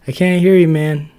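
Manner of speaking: high, loud singsong dodge; teasing Max. Acted. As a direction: pretend not to hear. Max ignoring Ted.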